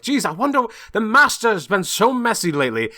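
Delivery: high, put-upon butler voice; fussy butler. Pretend. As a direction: grumble like a tidy servant. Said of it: "he comes in sweeping, like".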